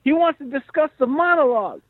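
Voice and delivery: very high-pitched old-school manager impression; Henry. Manager sending his writer to the star's house, high caricature voice. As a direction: send the voice way up, playing the character manager. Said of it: "and Henry's like".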